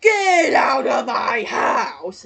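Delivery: slow, drawn-out mock shout; elongated cartoon holler; present-day Squidward impression. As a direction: stretch each word into a long yell. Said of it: "'Cause if I say it nowadays".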